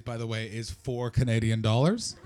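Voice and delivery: ordinary talk, nothing marked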